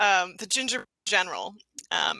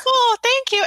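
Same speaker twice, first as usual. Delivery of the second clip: high, loud little creature; tiny voice; 6 dB louder than usual. Acted. Gingerbread man thanking Santa for not eating him, high tiny voice. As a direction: squeak it gratefully. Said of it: "you hear these tiny voices".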